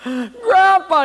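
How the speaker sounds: high, loud tearful wail; boy sobbing to his grandpa; crying boy. Acted. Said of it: "he came crying to Grandpa".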